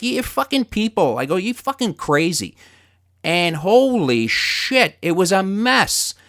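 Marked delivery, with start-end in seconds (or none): 0.0-2.6 angry insult. Retold or imitated. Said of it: "And I'm like".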